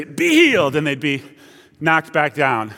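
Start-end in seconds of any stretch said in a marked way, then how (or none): 0.0-0.7 high, punched mock healing cry; Benny Hinn impression. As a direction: command a healing like a TV preacher, pitch leaping up. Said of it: "Benny Hinn would say".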